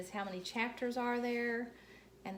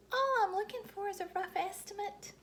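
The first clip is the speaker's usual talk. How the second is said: put-on much higher prim child voice; high little girl; 12 semitones higher than usual. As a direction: sound reasonable and grown-up as a little girl. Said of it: "I said".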